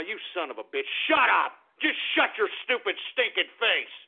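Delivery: ordinary delivery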